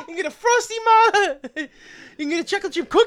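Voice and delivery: high, hollered Italian-guy questions, laughing; classic Italian guy. Retold or imitated. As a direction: bellow the options to Ma. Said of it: "sounds like classic Italian guy".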